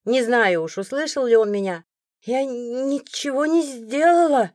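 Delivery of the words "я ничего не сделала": slow, higher freezing protest; drawn-out, stammering plea; struggling to speak. A narrator's reading. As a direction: force the words out haltingly through shivers. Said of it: "Слова давались с трудом".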